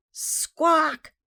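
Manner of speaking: piercingly high, very loud parrot squawk. A narrator's reading. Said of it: "even louder".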